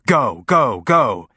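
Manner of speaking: loud, pushing urgency; urgent command. A narrator's reading. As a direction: hurry them through the door. Urgent order to rush in.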